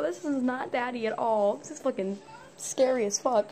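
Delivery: ordinary delivery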